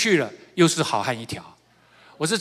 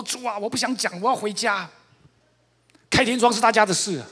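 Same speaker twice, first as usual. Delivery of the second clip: high-pitched retold tearful plea; mock wailing; 6 semitones higher than usual. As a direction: whine it upward, half-heartedly imitating a cry. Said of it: "我在那里面大哭啊".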